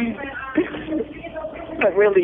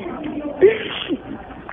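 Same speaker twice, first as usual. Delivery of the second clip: high sob; 9 semitones higher than usual. Real, not acted. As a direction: break into a brief sob mid-plea. Breaks down while begging please.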